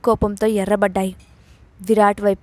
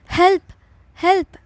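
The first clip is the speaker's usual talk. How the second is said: shouting for help; 7 semitones higher than usual. A narrator's reading. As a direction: yell it out, scared, knife at her throat. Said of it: "అని గట్టిగా అరిచింది".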